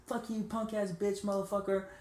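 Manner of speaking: high-pitched retold insults; mimicked hooker abuse. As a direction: imitate a hooker hurling abuse, without loudness. Hooker keeps insulting him.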